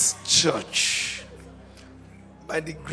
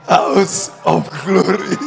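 laughing; 10 dB louder, slower than usual. Real, not acted. Laughing while naming church.

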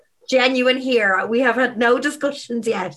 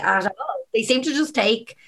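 usual speaking voice

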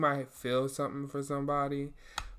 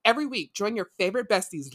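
announcer; 7 semitones higher, 12 dB louder than usual. Acted. Podcast intro read.

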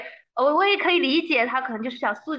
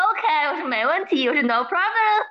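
eager agreement; 4 semitones higher, 7 dB louder than usual. Retold or imitated. 兴奋地答应凌晨面试.

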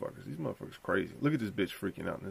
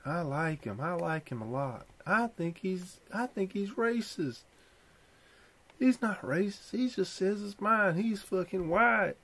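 much higher, slow, mocking fan voice; higher put-on fan voice; mock Trump fan; 10 semitones higher, slower than usual. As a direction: play a naive fan defending him.

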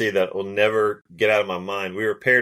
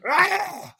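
high frustrated yelp; retold caddie's angry yell at a lost coin; mock angry yell. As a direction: imitate his burst of rage, up high. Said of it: "gets so mad".

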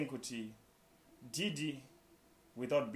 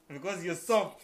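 laughing; 5 semitones higher, 6 dB louder, slower than usual. Real, not acted. Teasing with a laugh.

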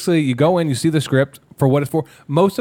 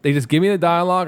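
ordinary delivery

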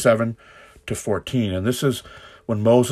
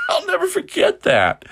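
laughing; slower than usual. Real, not acted. Laughing at the deacon memory.

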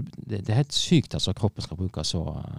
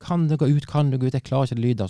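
imitating the daughter's scream, higher and louder; mock her desperate yell, pitched up; retold scream; 6 semitones higher, 5 dB louder than usual. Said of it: "jeg hylte og skrek". (gjengitt skrik, høyere og lysere)

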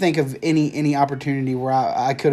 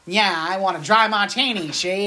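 higher mock mobster ordering a drink; old-time gangster voice; 4 semitones higher than usual. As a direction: ham up an old movie gangster. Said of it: "he does it in that voice".

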